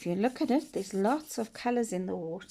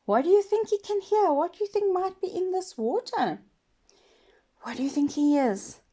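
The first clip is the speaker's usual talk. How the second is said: put-on lively high questions for kids; excited questions; 8 semitones higher than usual. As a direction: ask the children eagerly. Teacher asking children, animated.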